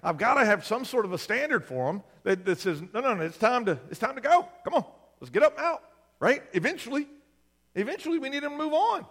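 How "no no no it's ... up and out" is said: urging parent. Retold or imitated. Parent rousing lazy adult kid.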